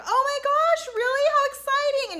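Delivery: high, gushing thrilled voice; mock excitement. Heather and Ashley hearing the Frenchman news, high mock gushing. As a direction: gush like a starstruck fan. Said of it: "Heather and Ashley are like".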